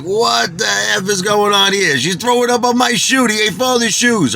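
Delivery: high-pitched acted outrage; freaking out. Man freaking out over his soiled shoes, high and indignant. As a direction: replay the panic, pitch shot way up. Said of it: "So now I'm freaking out".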